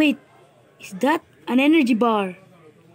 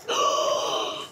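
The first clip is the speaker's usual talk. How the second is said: gasp; 5 dB quieter than usual. Real, not acted. Sudden find in game.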